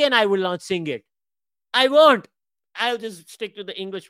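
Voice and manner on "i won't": emphatic. Real, not acted. Emphatic refusal.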